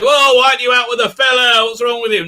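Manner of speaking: a light touch of a nagging mum; nagging mother. Retold or imitated. Mocking the nagging mother scolding her son. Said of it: "nags him".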